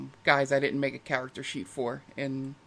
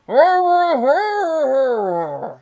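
muffled high wail from inside; strangled cry trapped in a rug; dinosaur voice; 10 semitones higher, 8 dB louder than usual. Acted. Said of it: "all you hear from the inside is Pizza Tacos going".

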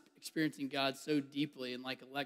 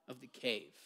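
ordinary delivery

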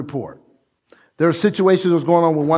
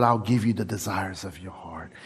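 soft hushed whisper, much softer; God's whisper; 10 semitones lower, 8 dB quieter than usual. Retold or imitated. Preacher voicing God to the congregation, hushed whisper. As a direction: breathe the promise as God.

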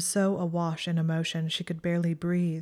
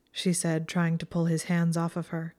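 ordinary delivery, nothing marked

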